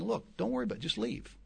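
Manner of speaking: softly, half-whispered, kindly letting them go; a soft pastor's shrug, no pressure; gentle advice. Retold or imitated. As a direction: ease them out the door gently. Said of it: "just gently saying".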